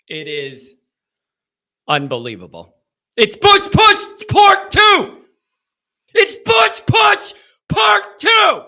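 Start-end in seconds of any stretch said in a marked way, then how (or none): 3.2-8.6 slow, high-pitched full yell; exasperated shout. Real, not acted. Radio host venting about Bush Push Part Two, exasperated shout. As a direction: shout it out, each word drawn out. Exasperated outburst over the game.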